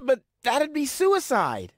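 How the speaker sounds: high, drawn-out whine of protest; alarmed protest. Acted. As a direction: object in a high, slow wail. Cornered man protests her threat.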